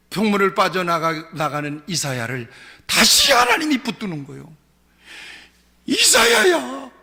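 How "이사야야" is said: high, drawn-out call. Acted. God calls the departing prophet back, calling out. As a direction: call after someone, stretching the name.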